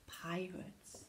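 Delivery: hushed, breath-heavy near whisper; shuddering dread. Acted. Captain spotting pirates in the clearing, hushed dread. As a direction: let it out softly with dread. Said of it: "peered into it and shuddered".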